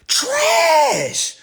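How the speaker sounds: high, loud scornful shout; mocking yell at the audience; shouted verdict. Real, not acted. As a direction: yell it like booing a losing team.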